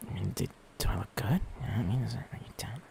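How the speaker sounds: hushed aside; whisper. Real, not acted. Streamer whispering an aside to chat. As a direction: whisper it as if no one should hear.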